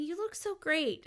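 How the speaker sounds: high, drawn-out retold compliment; acted-out delighted praise; happy and delighted. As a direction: gush admiringly at an old friend. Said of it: "happy and delighted".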